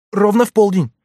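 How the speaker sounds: put-on high, urgent pleading boy voice; frightened page boy. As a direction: plead anxiously like a scared young page. Clarence answers fearfully.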